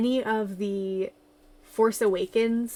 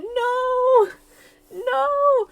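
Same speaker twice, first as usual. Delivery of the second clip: high shrieked protest, much louder; indignant no; 14 semitones higher, 7 dB louder than usual. Real, not acted. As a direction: squeal no at him. Fan protests being called fake.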